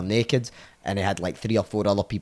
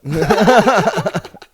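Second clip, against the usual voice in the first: loud high burst of laughter; 10 semitones higher, 10 dB louder than usual. Real, not acted. Teller laughing at his Billy story, loud burst of laughter. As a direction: crack up at the punchline.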